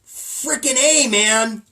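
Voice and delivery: loud, slow, hammed-up cursing; mock swearing. Host pretending to swear over ticket sales, loud and slow. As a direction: draw out the fake swear words. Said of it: "I might even swear".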